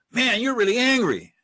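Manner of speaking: loud, high, emphatic empathy; matching a yeller's intensity. Shown as a demonstration. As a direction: meet their yelling with forceful acknowledgement. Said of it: "Somebody is yelling".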